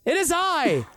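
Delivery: high, haughty, indignant noble voice; outraged pompous lord, laughing through it; pompous lord demanding respect; Verdon Kemble impression. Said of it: "in perfect Verdon Kemble accent".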